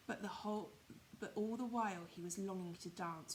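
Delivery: plain reading voice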